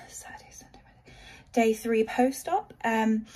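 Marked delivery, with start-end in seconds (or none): 0.1-1.1 whispered count to herself; whispering. Real, not acted. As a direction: whisper the days under your breath. Counting days under her breath.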